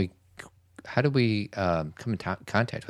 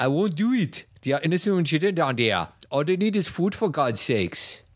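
put-on high, heavy Austrian accent; Arnold Schwarzenegger; 8 semitones higher than usual. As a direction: do a big Austrian action hero. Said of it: "Arnold Schwarzenegger?".

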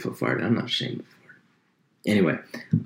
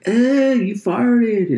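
put-on much higher, slow, louder teasing kid; kid classmate; 6 dB louder, much slower than usual. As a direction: drag it out like a taunting schoolkid. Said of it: "you're like".

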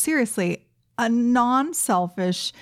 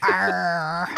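pirate voice; 4 semitones lower than usual. Pretend. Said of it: "Like a pirate".

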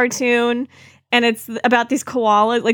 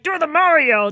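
cartoon host shout; 4 semitones higher than usual. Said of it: "and he's like".

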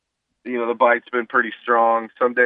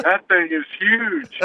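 put-on amazed kid; 8 semitones higher, much slower than usual. Kid's wide-eyed amazement retold.

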